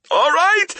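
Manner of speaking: high, loud panicked scream. A narrator's reading. Thief giving in under torture, high screaming. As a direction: scream it in surrender. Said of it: "the man screamed".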